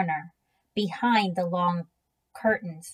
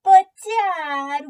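put-on loud, high childish whine; boy whining at his dad; 9 semitones higher, 8 dB louder than usual. A narrator's reading. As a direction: complain like a kid who doesn't want to. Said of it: "Willie whined".

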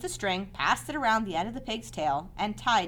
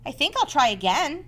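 bright, cheerful resolve; 7 semitones higher, 6 dB louder than usual. Acted. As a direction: perk up and try again. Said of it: "said Wilbur cheerfully".

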